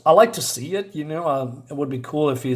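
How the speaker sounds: ordinary delivery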